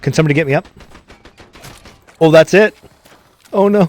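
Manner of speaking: loud, high call for a revive; man down shout. Real, not acted. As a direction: yell to your team, you're down. Downed in game, calling out.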